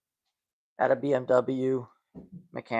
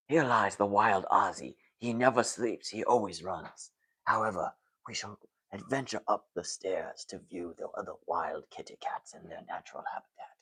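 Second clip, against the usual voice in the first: hushed, lower documentary narration fading to a whisper; softly narrating the wild cats, lower; David Attenborough impression; 7 semitones lower, 7 dB quieter than usual. Shown as a demonstration. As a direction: narrate the cats like a nature film. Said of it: "why can't I do a good David Attenborough impersonation".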